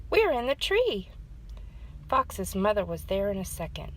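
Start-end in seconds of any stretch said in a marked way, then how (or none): 0.1-1.1 shouting back. A narrator's reading. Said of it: "Fox shouted back".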